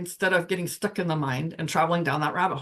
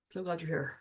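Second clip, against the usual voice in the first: soft repeat; 10 dB quieter than usual. Pretend. Echoes the welcome, much softer.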